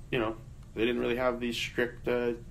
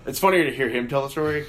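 normal talking voice

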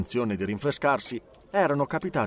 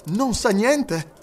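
high, drawn-out, keen question; lively, eager question; 8 semitones higher, 4 dB louder, much slower than usual. A narrator's reading. Detective asking whether the master knows, lively. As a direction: ask it slowly but with vivacity. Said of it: "chiese Fix con vivacità".

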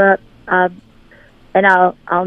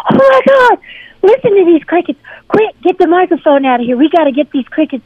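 much higher, louder, thrilled gasp at the crickets; excited discovery; 10 semitones higher, 3 dB louder than usual. Retold or imitated. Stepping onto the studio balcony, she rushes the crew: excited. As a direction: burst out as if stumbling on treasure.

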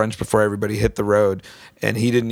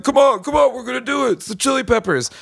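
put-on drunk pleading; 14 semitones higher, faster than usual. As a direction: beg like a drunk buddy. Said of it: "He's like".

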